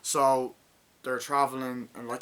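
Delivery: normal talking voice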